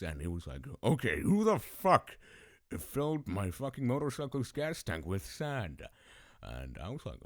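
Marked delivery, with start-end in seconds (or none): 0.8-5.8 loud, high angry yell; furious dad. Acted. Dad confronting kids about the sand, acted furious shout. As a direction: roar it like an outraged father. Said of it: "angry, furious".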